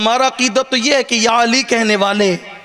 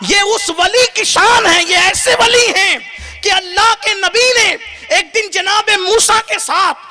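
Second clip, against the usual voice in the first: full-force preaching; 11 semitones higher than usual. Acted. Preacher praising Ghaus Pak to the crowd, impassioned high preaching. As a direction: raise the whole hall with rising fervour.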